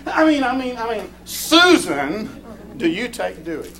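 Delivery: high-pitched stammering self-correction; mock fluster, pitched well up; imitated flustered pastor. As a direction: act out a pastor catching his slip. Pastor flusters over nickname at wedding.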